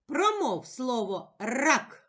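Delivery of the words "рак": "рак" with a long rolled р; emphasised first sound. Shown as a demonstration. Teacher demonstrating a first sound to a child, emphasised. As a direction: roll out the р that starts "рак". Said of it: "Який ми чуємо перший звук".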